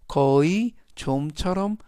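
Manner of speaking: usual speaking voice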